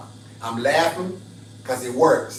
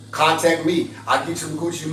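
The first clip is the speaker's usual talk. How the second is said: ordinary talk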